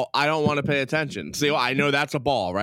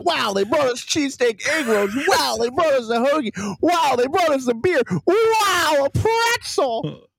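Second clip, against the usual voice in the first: mock amazement; 11 semitones higher than usual. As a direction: build a fake awe that gets shriller with every item. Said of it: "this whole thing has been like".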